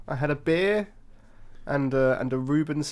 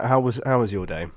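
usual speaking voice

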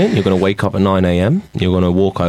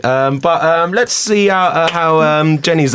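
high host voice; 8 semitones higher than usual. Acted. Host voice after the scene.

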